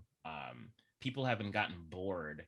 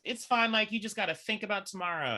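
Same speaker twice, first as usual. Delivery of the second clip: high, loud and quick; acted upbeat reassurance; 12 semitones higher, 9 dB louder, faster than usual. Character on the table rallying everyone, high loud reassurance. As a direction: play a pep talk, pitched up. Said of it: "she just goes".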